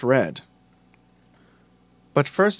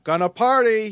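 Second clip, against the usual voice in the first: high, whooping party cry; exclaimed; 10 semitones higher, 3 dB louder than usual. A narrator's reading. Nick waving vodka at his friends, exclaiming. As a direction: call it out, ready to party. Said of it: "he exclaimed".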